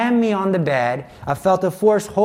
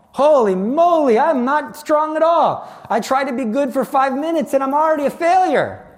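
heartbroken; 8 semitones higher than usual. Retold or imitated. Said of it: "I was so heartbroken".

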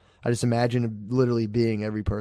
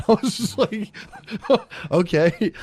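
laughing; 9 semitones higher, slower than usual. Real, not acted. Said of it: "laughing while retelling".

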